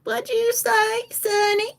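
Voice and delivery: put-on very high-pitched old lady voice. Old lady answering her rescuer, in a high granny voice. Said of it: "and this old lady, she's just like".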